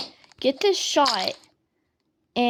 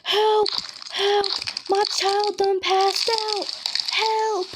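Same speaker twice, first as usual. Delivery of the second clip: retold panicked scream; 9 semitones higher, slower than usual. Dad yelling for help carrying fainted daughter, high frantic mock shout. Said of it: "he's screaming".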